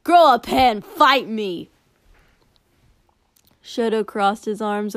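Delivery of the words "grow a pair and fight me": loud challenging yell; taunting shout. Acted. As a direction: shout the dare across the arena. Warrior taunts Shoto in fight.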